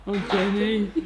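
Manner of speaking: put-on film-hero voice; Forrest Gump. Colleague teasing someone named Jenny, film impression. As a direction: imitate the film hero sighing over his love. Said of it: "You know, from Forrest Gump".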